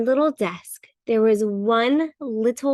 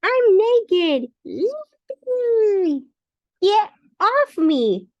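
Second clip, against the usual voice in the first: squeaky crayon voice; 9 semitones higher, slower than usual. Acted.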